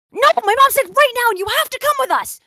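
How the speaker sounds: high, loud, whiny mock girl; mocking girl's demand. Mocking the friend who demanded he get up, high and loud. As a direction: play her up as a bossy, high-pitched girl.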